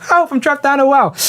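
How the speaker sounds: high-pitched cry for help; Timmy O'Toole. Retold or imitated. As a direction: squeak it out like a trapped kid. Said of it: "Timmy O'Toole".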